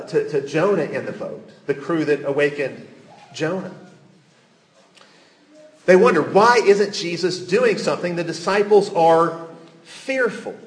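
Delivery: ordinary talk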